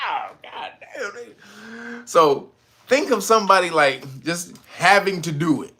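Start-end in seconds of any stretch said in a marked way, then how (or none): 0.1-2.1 1 hushed cringe. Retold or imitated. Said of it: "be like".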